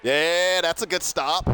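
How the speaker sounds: loud, high cheer for the stop; excited cheer. Real, not acted.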